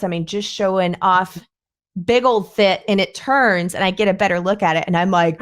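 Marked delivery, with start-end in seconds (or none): none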